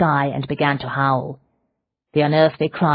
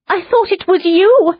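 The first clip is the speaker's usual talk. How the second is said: very high, louder shout of relief and fear; frantic shout; 7 dB louder than usual. A narrator's reading. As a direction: cry it out, terrified it was him. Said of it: "she shouted".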